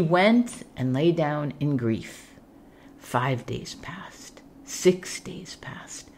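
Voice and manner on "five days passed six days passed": slow, hushed whisper. A narrator's reading. Narrator marking the prince's grieving days, whispered. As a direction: breathe the days out softly. Time passing in grief.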